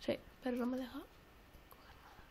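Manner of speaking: whispered complaint, voice held down; hushed whisper. Real, not acted. Sneaking near the enemy, whispered complaint. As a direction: grumble it in a whisper.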